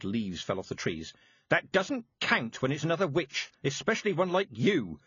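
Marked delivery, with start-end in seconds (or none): 1.5-5.0 a high stern snap; lightly voiced snapping old witch; snapped. A narrator's reading. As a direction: cut her down to size. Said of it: "Mistress Weatherwax snapped".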